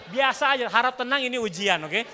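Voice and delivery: loud, high, laughing mock calm-down; hammed-up call for calm; mock announcement. Pastor joking to the congregation that stays calm, loud mock announcement.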